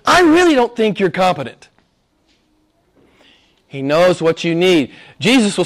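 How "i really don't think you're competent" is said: higher and louder, mockingly telling God he's incompetent; mock condescending prayer. Preacher mocking a presumptuous pray-er, condescending. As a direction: send up a smug pray-er. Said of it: "you're saying to him".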